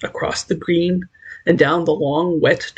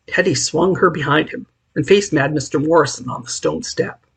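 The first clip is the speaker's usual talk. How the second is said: plain reading voice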